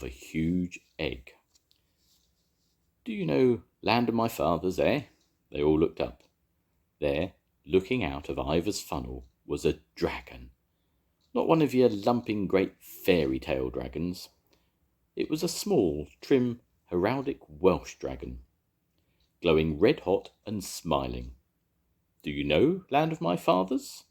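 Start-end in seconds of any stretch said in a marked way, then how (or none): 3.0-5.0 lightly voiced small dragon; a gentle, higher dragon asking. A narrator's reading. The dragon asking the engines about the song, lightly voiced. 22.2-24.0 high, bright-toned little dragon; small dragon. A narrator's reading. The dragon repeating its question, higher and hopeful.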